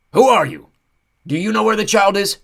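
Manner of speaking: high loud jittery creature; scattered and frantic; frantic centaur. Acted. Centaur dances around, frantic.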